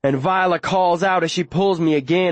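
ordinary delivery

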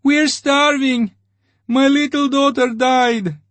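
starving crowd pleading and jeering at the major; babbling crowd. A narrator's reading. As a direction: beg and jeer like a starving mob. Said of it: "the crowd said in a babble of voices".

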